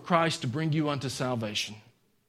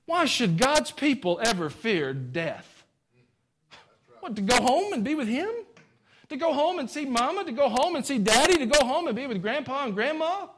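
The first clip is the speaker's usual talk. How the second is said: high, lilting preacher's cadence; impassioned preaching; 12 semitones higher than usual. Real, not acted. Preacher rousing church about going home, impassioned. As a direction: preach it high, question upon question.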